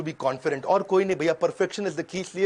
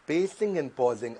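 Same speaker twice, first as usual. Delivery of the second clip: very slow, lower, with deliberate pauses; pacing and pausing; 5 semitones lower, 4 dB quieter, much slower than usual. Shown as a demonstration. Coach demonstrates pausing to his audience, slow and measured. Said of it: "अब मैंने दे दिया pause".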